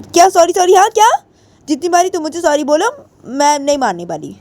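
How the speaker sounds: high-pitched cross retort; exasperated anger. Acted. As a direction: throw the apology back in a high voice. Angrily rejects repeated apology. (झुँझलाई ऊँची आवाज़)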